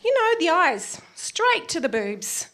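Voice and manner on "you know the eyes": ordinary delivery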